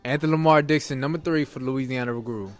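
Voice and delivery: normal talking voice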